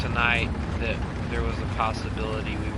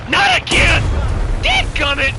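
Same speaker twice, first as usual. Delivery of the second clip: high, loud annoyed shout; frustrated yell; 13 dB louder than usual. Real, not acted. Game setback outburst.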